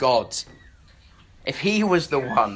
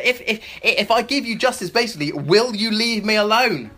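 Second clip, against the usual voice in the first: exasperated judge; 5 semitones higher, 4 dB louder than usual. Retold or imitated.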